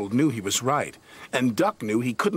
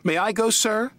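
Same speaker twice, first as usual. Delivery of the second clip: high, bright eager ask; volunteer keenly; 10 semitones higher, 3 dB louder than usual. Acted. Donald eagerly asking Sir Topham Hatt to go. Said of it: "said Donald eagerly".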